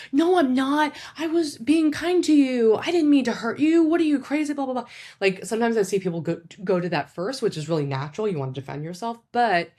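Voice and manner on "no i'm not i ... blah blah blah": high, louder mock argument, pushing back; a shrill-free hammed-up defensive protest; defensive arguing. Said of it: "spend a lot of time defending and arguing".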